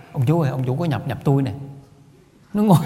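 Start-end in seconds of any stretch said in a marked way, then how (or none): none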